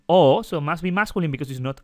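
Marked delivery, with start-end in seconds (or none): 0.1-0.4 "o," said on its own, loud and round; vowel sound demo. Shown as a demonstration. Teacher to students, naming the ending loudly. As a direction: belt out the single vowel "o,". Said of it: "mano finishing".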